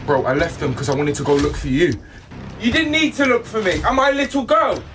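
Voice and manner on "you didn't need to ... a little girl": high, rising indignant shout; angry retort. Acted. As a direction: snap back in outrage. Angry quarrel with bro.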